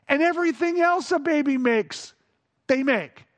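usual speaking voice

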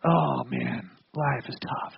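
low, downcast mumbled lament; discouraged sigh. Acted. Preacher voicing a discouraged person with dropped gaze, dejected. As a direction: let the voice drop, dejected. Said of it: "kind of drop your gaze".

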